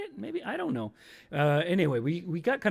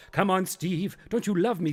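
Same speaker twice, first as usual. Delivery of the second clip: higher, coaxing stage voice; pleading pizza; 5 semitones higher, 3 dB louder than usual. Acted. Pizza begs Steve to love it, coaxing voice. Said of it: "says Pizza".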